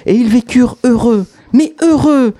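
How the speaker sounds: high, loud heureux stretched; storyteller overselling the ending with heureux; exaggerated happy ending. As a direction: ham up heureux, mais heureux.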